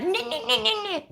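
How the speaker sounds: squeaky high mock no's through laughter; hammed-up high-pitched no no no; mocking refusal. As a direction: parody the guest's prissy protest. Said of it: "And it's like".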